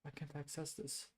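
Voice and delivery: ordinary delivery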